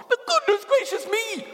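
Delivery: very high, startled faun voice; a squeal of fussy astonishment; startled exclamation. A narrator's reading. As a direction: burst out in high-pitched surprise. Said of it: "exclaimed the faun".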